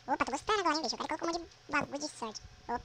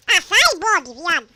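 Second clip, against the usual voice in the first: much louder, higher shout at the mob; angry yell; 4 semitones higher, 19 dB louder, slower than usual. Real, not acted. Player yells at a mob named Bob to move away. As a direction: yell it to drive him off.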